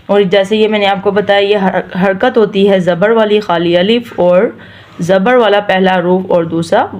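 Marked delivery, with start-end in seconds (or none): none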